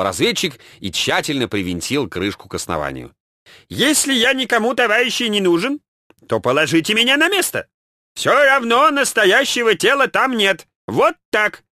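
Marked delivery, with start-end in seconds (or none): 3.7-11.6 тонкий картавый голос вождя; высоко и картаво, по-ленински. A narrator's reading. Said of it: "неожиданно сказал Ленин тонко картавым голосом". (тонко картавый Ленин)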